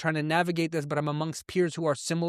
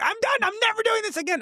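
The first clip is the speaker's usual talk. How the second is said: very high, louder wail of giving up; a kid who can't take any more; hammed-up distress, much higher; distraught child; 3 dB louder than usual. Kid bolting from gym upset.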